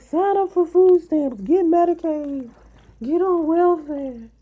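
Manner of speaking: loud, high and slow, mimicking preachy commenters; mocking know-it-all advice. Streamer mimics viewers pushing welfare on her, slow loud high mockery. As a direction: spell it out high and loud like a smug adviser. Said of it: "some people be like".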